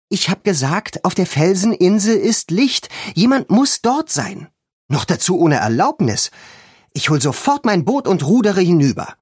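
determined to row over now; impatient insistence. A narrator's reading. Girl insists to her sleepy friend, high and excited.

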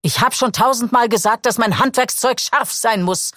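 high, sharp scolding surgeon; whipping angry voice. A narrator's reading. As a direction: lash out at the room. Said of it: "peitschte seine Stimme durch den Saal". (hoch und peitschend, wütend schimpfend)

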